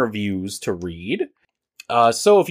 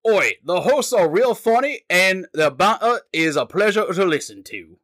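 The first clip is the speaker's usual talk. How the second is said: high, wobbly stab at British; hammed-up British with an "Oi"; slipping mock-British accent, pitched high; attempted British accent; 6 semitones higher than usual. Shown as a demonstration. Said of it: "I'm losing it".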